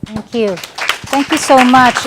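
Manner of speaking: ordinary talk, nothing marked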